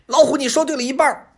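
high, loud, quick fox with a snicker; snicker, then bluff boldly; cocky high-pitched fox. A narrator's reading.

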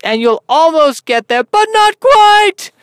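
loud, high, drawn-out host shout; hammed-up talk-show host; over-the-top show host. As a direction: belt it out, stretching the final words. Mock talk-show host bit.

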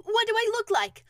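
high, loud question from above; calling down. Acted. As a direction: call the question down to someone below. Pooh calls down from balloon.

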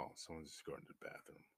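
quick whispered inner thought; thinking to oneself. Retold or imitated. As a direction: whisper a hasty self-reassurance. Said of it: "I'm thinking to myself".

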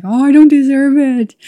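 high, loud self-doubting cry; high, loud inner voice of doubt. Retold or imitated. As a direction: voice the nagging imposter thought. Imposter syndrome inner voice.

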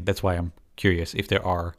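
normal talking voice

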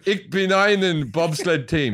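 slow Jamaican-tinged mock German; drawn-out German line in a Jamaican lilt; German turned Jamaican accent. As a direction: try German, let it come out Jamaican. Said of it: "That was my German".

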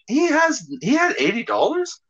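high, disbelieving question; can't believe a kid had eighty dollars; incredulous. Retold or imitated. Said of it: "I'm like".

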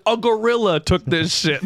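high, slow comic punchline; imitated outraged caller. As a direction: draw it out high, close to laughing.

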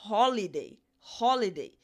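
'holiday' said, then echoed; British English word. Shown as a demonstration. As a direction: model 'holiday' twice. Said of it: "No inglês britânico".

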